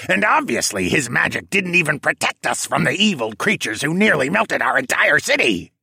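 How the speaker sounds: high, loud, squawky scorn; penguin villain rant. A narrator's reading.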